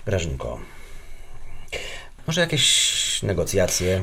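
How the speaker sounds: low, soft, slow peace offering; coaxing. Acted. Husband backing down to negotiate with his wife, coaxing. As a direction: back down and sweet-talk gently.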